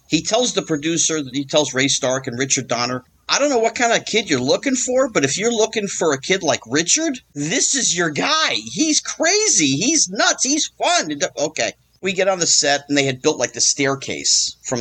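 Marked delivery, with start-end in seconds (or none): 3.3-11.1 excited pitch. Retold or imitated. Norman pitching him to producers.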